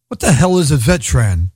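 usual speaking voice

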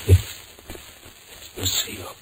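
whispered, slow and breathless with dread; panicked whisper. Acted. Caught by his girlfriend at the door, panicked whisper. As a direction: hush it out like you've been caught.

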